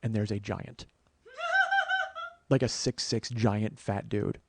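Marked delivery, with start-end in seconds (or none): 1.4-2.3 short, very high giggle; high-pitched laugh. Real, not acted. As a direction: let out a quick, high laugh. Laughs at the giant's arrival.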